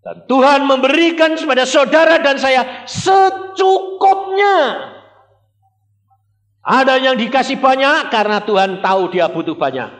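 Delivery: full-force preaching. Acted. Preacher proclaiming to the congregation, high and loud. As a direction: proclaim it from the pulpit.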